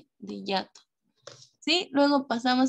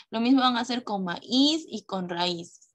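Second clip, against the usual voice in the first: ordinary talk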